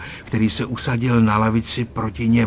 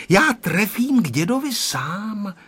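put-on boasting boy; 9 semitones higher than usual. A narrator's reading. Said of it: "kasal se Honzík".

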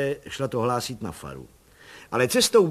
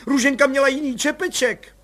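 high and louder, still alarmed; blurting mother; 11 semitones higher, 6 dB louder than usual. Acted. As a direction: keep the panic going. (vysoký, rozrušený hlas)

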